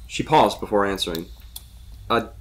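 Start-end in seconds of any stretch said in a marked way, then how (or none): 2.1-2.3 halting, high questioning tone; pause, then lift it into a question; tentative, uncertain guess; unsure. A narrator's reading. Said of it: "unsure of herself".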